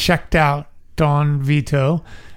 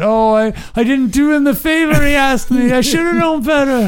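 terrified victim; 10 semitones higher than usual. Pretend. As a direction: cry out like a man who learned too late. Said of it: "each one, of course, has to say".

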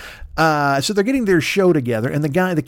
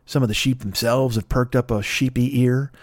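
ordinary talk, nothing marked

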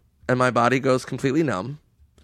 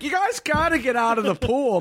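mock security guard; 11 semitones higher than usual. Guard voiced.

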